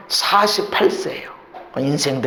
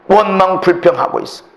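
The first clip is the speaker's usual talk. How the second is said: normal talking voice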